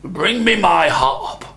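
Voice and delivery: calling out, slow and loud on the order; giant yelling. A narrator's reading. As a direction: bellow for the wife, dragging the words. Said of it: "he yelled at his wife".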